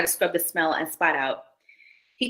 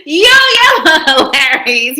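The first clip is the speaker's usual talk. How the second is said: loud slow laughing call-out to viewers; tickled by the chat's joke, loud and laughing; 7 semitones higher, 10 dB louder, slower than usual. Real, not acted. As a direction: shout it while cracking up. Cracking up at the story.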